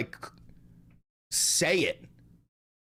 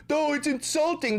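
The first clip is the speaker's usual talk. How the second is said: high mocking tone; mocking whine; 6 semitones higher than usual. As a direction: mimic a whiny complaint. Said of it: "mocking quote".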